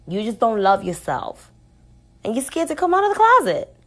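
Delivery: loud, high, punched-out accusations; angry confrontation. Real, not acted. Telling [name] to cut it out, loud and angry.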